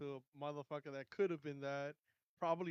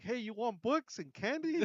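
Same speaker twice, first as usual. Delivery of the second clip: mock luring stranger; 7 semitones higher, 5 dB louder than usual. Kidnapper luring boy at library.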